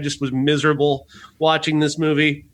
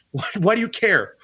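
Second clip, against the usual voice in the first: usual speaking voice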